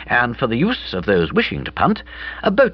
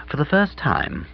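plain reading voice

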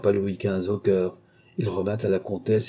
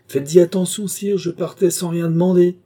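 high, louder countess voice; pointed warning; 8 semitones higher, 5 dB louder than usual. A narrator's reading. Countess warning the king before making demands, pointed. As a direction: warn him with raised pitch and force.

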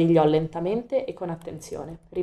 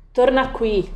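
calling out; 6 semitones higher, 4 dB louder, slower than usual. A narrator's reading. Coraline calls the cat back.

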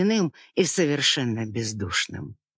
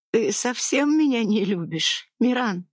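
higher, fragile hopeful question; 8 semitones higher than usual. A narrator's reading. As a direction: ask with last hope. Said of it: "с надеждой спросила она". (с надеждой, выше)

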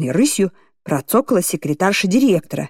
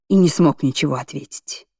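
ordinary delivery

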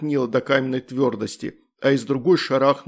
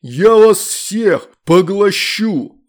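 very slow, much louder demon bellow; monstrous threat; 6 semitones higher, 8 dB louder, much slower than usual. A narrator's reading. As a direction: boom like a devouring monster. Demon threatens to devour all.

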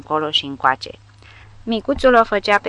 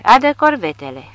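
loud, higher command; barked order; 4 semitones higher, 5 dB louder than usual. A narrator's reading. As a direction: order her to bring the girls. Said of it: "Caporalul îi expedie grăsunei un șut".

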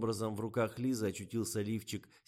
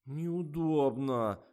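slow drawn-out whining; whiny; 8 semitones higher, 8 dB louder, much slower than usual. A narrator's reading. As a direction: whine it like a spoiled child. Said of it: "капризничала модель".

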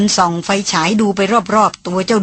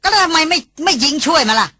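snapping retort; 7 semitones higher than usual. A narrator's reading. Brother snapping back at his sister, sharp and scornful. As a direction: snap back at her with scorn. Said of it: "อนุชากระชากเสียงมา".

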